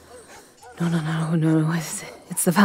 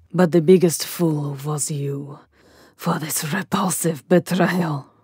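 usual speaking voice

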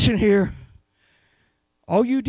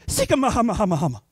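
mock tongues phrase; 4 semitones lower, 7 dB quieter, faster than usual. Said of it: "we've all memorized the tongue phrase".

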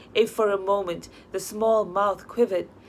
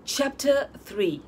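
ordinary delivery, nothing marked

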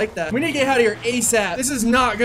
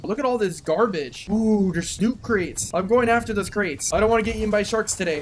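normal talking voice

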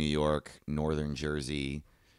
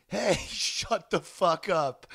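high, drawn-out mock holler; retold yell; 11 semitones higher, much slower than usual. Student yelling at a car alarm from orientation line, mock yell. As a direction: imitate hollering across a parking lot, not full volume. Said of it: "I just turn and yell".

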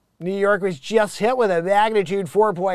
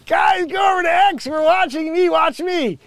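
excited shout; 11 semitones higher, 6 dB louder than usual. Real, not acted. Laughing at himself on X.

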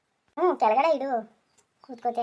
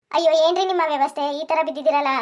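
startled exclamation; 3 semitones higher, 8 dB louder than usual. Acted. Wife finding her husband fallen, loud startled cry. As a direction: react to seeing him sprawled.

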